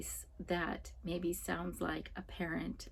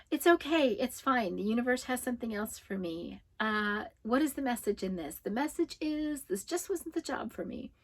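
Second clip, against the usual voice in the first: higher-pitched, imitated fake-positive spiel; forced positivity; 4 semitones higher than usual. Client covering her disappointment after losing the job, forced positivity. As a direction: mimic someone insisting all is fine, pitched up. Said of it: "what I call that is forced positivity".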